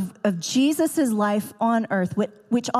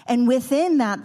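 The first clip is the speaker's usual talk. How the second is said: normal talking voice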